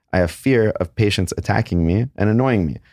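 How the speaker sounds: ordinary talk, nothing marked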